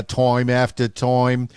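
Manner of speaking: slow sung English accent, time as toim. Shown as a demonstration. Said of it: "I sing it with a, an, an English accent".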